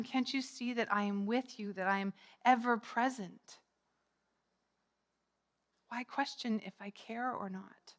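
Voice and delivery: soft reassurance. Acted. Jesus to doubting disciples, soft reassurance. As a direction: quietly reassure them of your presence.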